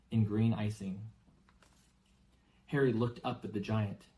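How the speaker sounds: plain reading voice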